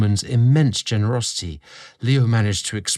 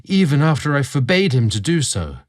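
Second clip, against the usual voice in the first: put-on a young servant's voice, raised and louder, full of worry; anxious young servant; 6 semitones higher, 5 dB louder than usual. Julian tells Leo his fear of losing his place, voice raised and anxious. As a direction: pour out your fear of being cast aside.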